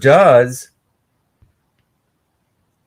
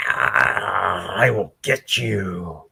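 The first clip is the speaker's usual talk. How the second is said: villain voice; 7 semitones lower, 6 dB quieter, much slower than usual. Acted. Villain voiced in comic retelling.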